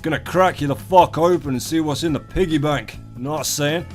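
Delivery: ordinary talk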